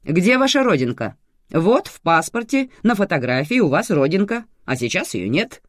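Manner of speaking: loud, high questioning; interrogating. A narrator's reading. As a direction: press her like a suspect. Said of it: "спрашивала так, будто вела допрос".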